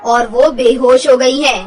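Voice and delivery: grave doctor. Acted. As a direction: deliver bad news like a solemn doctor.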